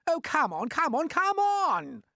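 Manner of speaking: high, slow exasperated urging; fed-up centipede nagging the glowworm; impatient. Acted. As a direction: drag out each come on impatiently.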